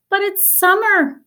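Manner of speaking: put-on high child voice calling out; a small boy's high, puzzled call; young brother calling out; little brother calling. A narrator's reading. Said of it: "her brother called".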